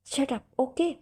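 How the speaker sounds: playful fake scolding, no real heat; mock anger. A narrator's reading. Hina scolds the giggling servant in mock anger. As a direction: pretend to be cross while fighting a laugh. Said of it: "बनावटी गुस्से में डांटा था".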